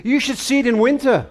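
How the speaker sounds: barked back. Retold or imitated. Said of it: "he barked back at me".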